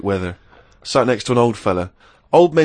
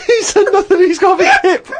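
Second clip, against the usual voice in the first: high, louder words through laughter; laughing; 6 dB louder than usual. Real, not acted. Laughing while reading.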